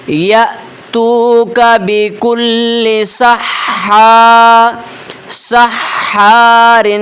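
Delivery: Quran recitation. Shown as a demonstration. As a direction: stretch each vowel like a reciter. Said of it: "آپ سنیے". (آہستہ، بلند آواز میں تلاوت)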